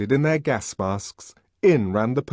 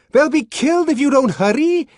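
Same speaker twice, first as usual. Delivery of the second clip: panicked urgent shout; 7 dB louder than usual. Acted. Bernard pleading with police.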